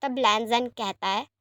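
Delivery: ordinary delivery, nothing marked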